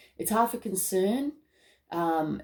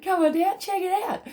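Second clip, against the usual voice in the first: excited; 12 semitones higher, 3 dB louder than usual. Acted. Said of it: "repeated invitation, pitched up".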